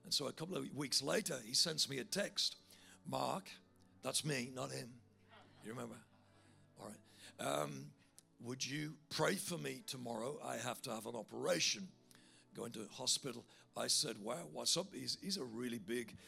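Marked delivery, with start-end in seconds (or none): none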